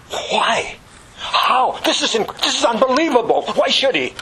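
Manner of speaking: high, baffled outcry; astonished disbelief. Acted. Preacher acting out stunned prophets asking why, incredulous. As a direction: question it as if you cannot take it in.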